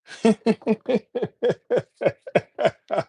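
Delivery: real laugh. Real, not acted. Laughs after Hasta la vista.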